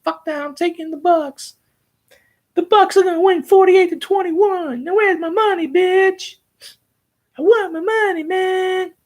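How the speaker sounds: high, cocky gloating, demanding payment; gloating bettor. Pretend. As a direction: crow over the winning bet. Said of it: "I shoulda went back in time and just like".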